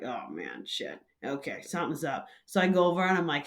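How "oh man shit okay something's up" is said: low and much softer, to herself; muttered panic. Retold or imitated. Barista realizing the regular is unresponsive, muttered alarm. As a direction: mutter it under your breath, alarmed.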